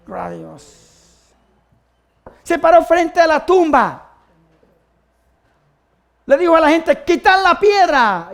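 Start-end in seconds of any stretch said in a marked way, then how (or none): 2.4-7.0 high, loud, slow proclamation; declamatory preaching. Acted. Preacher builds to the command. 7.0-8.3 high, drawn-out raised voice; commanding call. Acted. Acts Jesus's command at tomb.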